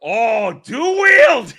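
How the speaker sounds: thrilled shout into laughter; excited shout. Real, not acted. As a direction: yell with delight at the reveal. Reacting to trailer feature.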